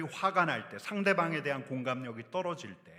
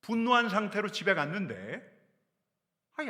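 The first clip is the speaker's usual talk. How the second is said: ordinary talk, nothing marked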